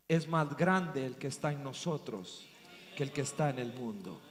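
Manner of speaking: low, hushed testimony; solemn preaching. Real, not acted. Preacher telling what the Holy Spirit showed him, hushed and solemn. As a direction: bring it down soft and low, with weighty pauses.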